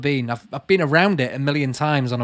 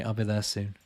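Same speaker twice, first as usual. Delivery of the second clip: put-on much softer and lower, fading away like a crumbling old man; faint, fading mock-old voice; 5 semitones lower, 8 dB quieter than usual. To a viewer born the year he was, as if fading away to dust, softer and lower. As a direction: let the repeat die away, as if turning to dust.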